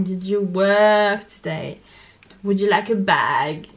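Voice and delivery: drawn-out vowels in "work" and "bag", loud; Australian-style stretched "work" and "bag"; very long letter. Shown as a demonstration. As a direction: lean slowly on "work" and "bag". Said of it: "La lettre va être très longue".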